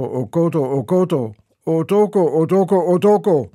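loud, high chanted recitation of sound poem; poem recital. Acted. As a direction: chant each oh Gott out loudly, playing with the vowels. Poet recites his sound poem.

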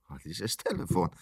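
low, soft grumble at the phone; annoyed mutter. Acted. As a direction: mutter it under the breath. Annoyed aside during phone call.

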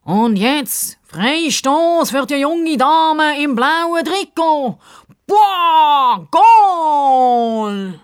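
high, loud, drawn-out commentator build to a huge goal cry; mock football commentary. A narrator's reading. Sidekick announcing the young lady's free kick, mock commentary. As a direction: stretch it out, then roar the goal.

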